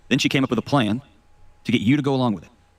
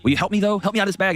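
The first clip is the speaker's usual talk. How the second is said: higher-pitched wheedling plea; lightly hammed-up cajoling; mock pleading; 10 semitones higher than usual. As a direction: beg for a favor in an acted voice. Snake begs once more in fable.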